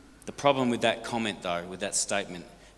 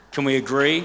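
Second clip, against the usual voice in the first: loud, raised-pitch appeal to the crowd; rallying call; 4 semitones higher, 8 dB louder than usual. Real, not acted. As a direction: call out for the room's agreement. Rallying the audience for agreement.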